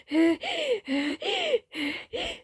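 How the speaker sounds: high panting gasp; breath-catching gasp. Acted. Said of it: "brief moment of breath catching".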